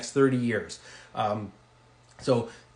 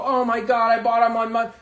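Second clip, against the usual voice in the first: higher, louder fake alarm; mock dismay; 7 semitones higher, 4 dB louder than usual. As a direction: play it like a shopper who overpaid. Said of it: "You're not gonna go".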